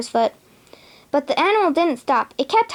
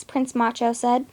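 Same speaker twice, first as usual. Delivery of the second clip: ordinary delivery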